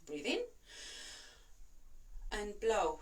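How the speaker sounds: calm breathing cue, breath in then a blow; guided breathing. Shown as a demonstration. Coach breathes along with a coughing person, calm cue. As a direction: lead them through one breath. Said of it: "So a couple more times".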